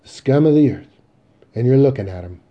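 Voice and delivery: loud, emphatic self-condemnation; self-disgust. Acted. Son on the floor before his mother, loud self-disgust.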